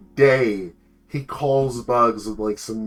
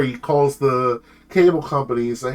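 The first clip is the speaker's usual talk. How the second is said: ordinary talk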